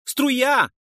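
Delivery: high, loud cry of alarm; shouted warning. A narrator's reading. Noise warns his partners of the incoming jet, shouted. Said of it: "выкрикнул Нойс".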